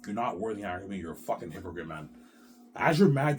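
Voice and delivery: ordinary delivery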